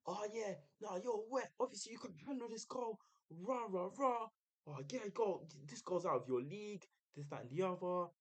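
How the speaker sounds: high-pitched mock heckling; put-on high jeer; retold shouting. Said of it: "he was shouting the whole time".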